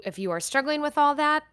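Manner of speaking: usual speaking voice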